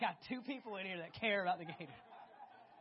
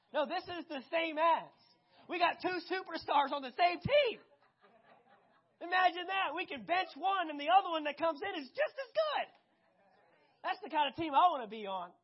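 excited; 5 semitones higher, 7 dB louder than usual. Real, not acted. Preacher builds excitement over superstars.

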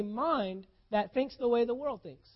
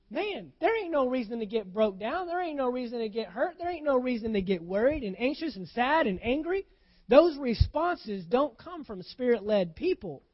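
preaching; 4 dB louder than usual. Real, not acted. Preaching at full force.

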